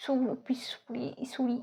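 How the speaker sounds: सू- पिसूड़ी said with the p sounded, softly, almost whispered; p not silent. Shown as a demonstration. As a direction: try पिसूड़ी with a spoken p, under the breath. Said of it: "कैसे पढ़ेंगे अगर मैं बोले इसको कि पी silent नहीं है".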